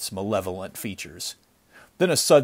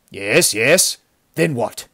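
loud, impatient prompting; raging impatience; 6 dB louder than usual. A narrator's reading. Chief pressing the survivor for more, impatient. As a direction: hurry him on with irritation. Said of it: "was raging with impatience".

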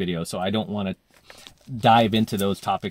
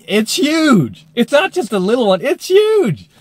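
much higher and louder, pleading that it's huge; exaggerated whine; 13 semitones higher, 9 dB louder, slower than usual. Telling his date about the zit, high and loud. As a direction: squeal it like a mortified teen.

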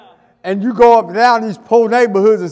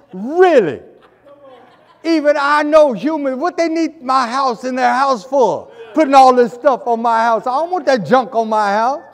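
lightly voiced indignant owl, a bit higher; confused owl; 4 semitones higher, 4 dB louder than usual. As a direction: play a baffled owl complaining. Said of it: "It's like".

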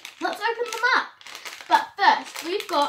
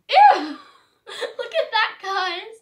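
squealing ew then laughing; grossed out and giggling; disgusted laugh; 4 semitones higher, 10 dB louder, much slower than usual. Real, not acted. Sees the slug candy.

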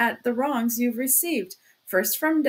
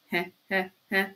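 dry "heh, heh, heh"; low, evenly spaced little chuckles; sly chuckle; 3 semitones lower than usual. A narrator's reading. Investigator toying with his suspect, sly chuckle.

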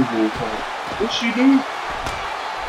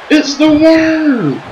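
excited; 11 semitones higher, much slower than usual. Acted. Wrestler entrance hype call.

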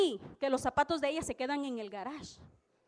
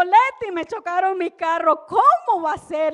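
distressed plea; 6 semitones higher, 11 dB louder than usual. Retold or imitated. Tells Leti car was hit.

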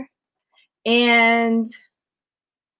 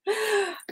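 sharp gasp; 9 semitones higher, 9 dB quieter than usual. Real, not acted. Bracelet breaks on camera.

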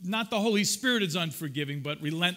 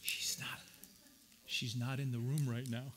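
softer conspiratorial joke; whisper; 10 semitones lower, 11 dB quieter than usual. Real, not acted. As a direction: drop the voice as if she might hear. Joking aside about his wife.

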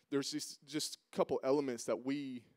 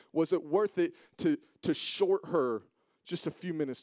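louder, higher, pressing preacher's build; preaching; 5 semitones higher, 7 dB louder than usual. Acted. Pastor confronting himself before the congregation, preaching at full force. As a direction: drive each question home harder. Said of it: "preacher questions himself with rising force".

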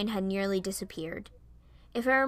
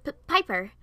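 stuttered, louder correction; higher, emphatic stammered name; quick correction; 5 semitones higher, 5 dB louder than usual. Acted. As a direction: correct them, tripping on the first sound. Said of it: "I quickly corrected her".

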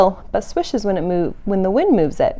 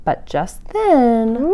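ordinary delivery, nothing marked

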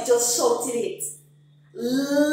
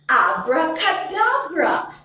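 loud, bright abracadabra; magic word shout; 4 semitones higher, 7 dB louder than usual. Shown as a demonstration. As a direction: cast the spell out loud. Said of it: "Say the magic word".

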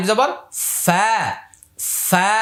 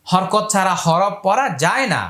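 ordinary talk

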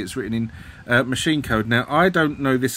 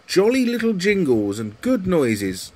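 put-on higher-pitched old-fashioned reviewer voice; mock old person; 6 semitones higher than usual. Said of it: "in the style of old people".

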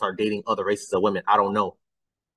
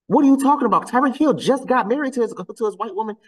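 high-pitched exasperated disbelief; incredulous; 11 semitones higher, 3 dB louder than usual. Real, not acted. Calling out commenters over old news, incredulous. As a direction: challenge them, voice jumping way up.

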